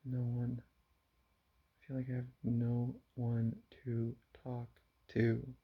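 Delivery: usual speaking voice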